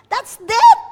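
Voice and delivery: sudden high, loud preaching cry; booming declaration of death; loud proclamation. Real, not acted. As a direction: proclaim it at full force.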